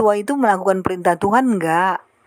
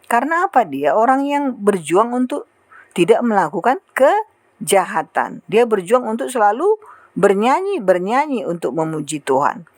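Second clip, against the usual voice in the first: ordinary talk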